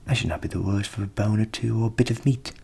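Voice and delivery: hungry dog. A narrator's reading. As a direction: growl a little longing for a bone. Dog voiced.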